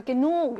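"genug" with a drawn-out u; long vowel. Shown as a demonstration. Teacher applies the stress rule aloud, long u in "genug". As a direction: stretch the second vowel of "genug". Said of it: "역시 길게".

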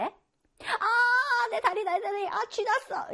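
high, pained crying out; painful cramp cry. Acted. Teacher acting a student with a leg cramp, pained cry. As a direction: wail about a cramping leg.

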